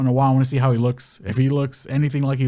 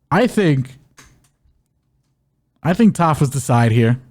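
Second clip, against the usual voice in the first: normal talking voice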